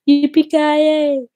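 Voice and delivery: high, loud, slow yippee-ki-yay call; drawn-out catchphrase call. Acted. Streamer calling out a Die Hard catchphrase, high drawn-out holler. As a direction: stretch it out as a high, playful holler.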